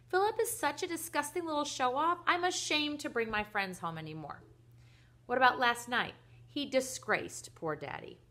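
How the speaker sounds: loud, high-starting rant about a brother; acted aggrieved daughter; indignant complaint. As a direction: pour out a child's outrage. Daughter rants about her brother.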